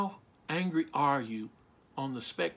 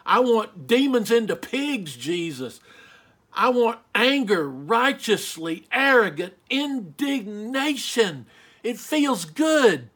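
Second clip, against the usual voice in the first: loud, emphatic preacher's anger, higher pitched; righteous indignation; 3 semitones higher, 6 dB louder than usual. Real, not acted. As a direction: hammer each key word with fierce indignation. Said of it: "even when I speak of anger, I clench my fist. I feel it".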